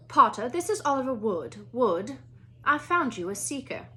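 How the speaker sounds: clipped headmistress asking politely; a stern older Scottish witch at a classroom door; McGonagall voice. Acted.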